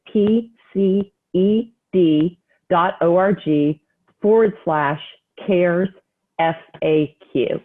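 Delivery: measured dictation, pausing after each part; slow read-out. Real, not acted. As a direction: slowly repeat the address piece by piece. Repeats the web address for listeners.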